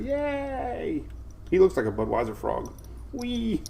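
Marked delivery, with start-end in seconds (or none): 0.0-1.0 mock baby cheer. 3.1-3.6 playful squeal. Pretend. Playing with the baby.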